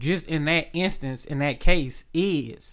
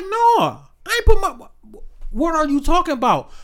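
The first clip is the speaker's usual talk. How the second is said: high, stammering, scared denial; panicked denial; 9 semitones higher, 3 dB louder than usual. Retold or imitated. Boyfriend panics as the dad comes at him, high stammering. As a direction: back away from the accusation, voice cracking up. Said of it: "He like".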